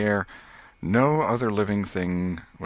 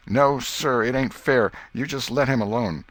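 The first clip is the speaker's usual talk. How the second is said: put-on raised, heated boy's protest, pitched higher; angry Joe; 6 semitones higher, 5 dB louder than usual. A narrator's reading. Joe to Tom over the tick, angry and louder. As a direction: snap it back, a boy defending his turn. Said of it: "Joe was angry in a moment".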